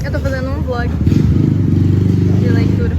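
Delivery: loud call across the room. Real, not acted. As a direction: shout the answer to another room. Calling out to someone in the room. (respondendo em voz alta)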